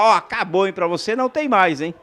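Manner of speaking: high, loud scolding referee voice; stern referee warning. Retold or imitated. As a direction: warn him off like a strict referee. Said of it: "aquela advertência verbal que a arbitragem gosta de falar". (bronca de árbitra, alta e firme)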